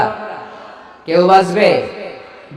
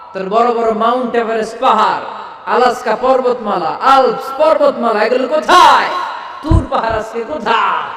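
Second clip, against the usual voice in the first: higher, louder preaching shout; fiery preaching; 5 semitones higher, 4 dB louder than usual. Acted. Preacher demanding where the mountains are, fiery shout. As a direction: hammer each question at the crowd.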